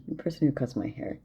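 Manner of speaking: ordinary talk, nothing marked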